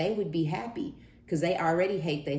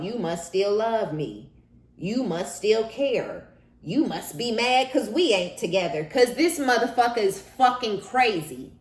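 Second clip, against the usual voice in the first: sarcastic ex voice, higher and louder; mocking the ex; 6 semitones higher, 5 dB louder than usual. Mocking the ex's reasoning.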